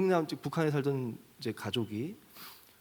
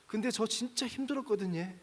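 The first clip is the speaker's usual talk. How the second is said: higher, softer, a hint of tears; lightly voiced choked-up son, pitched up; retold crying, higher; 7 semitones higher, 4 dB quieter than usual. Preacher retells the son's words to his father, higher and mildly tearful. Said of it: "이러면서 막 울어요".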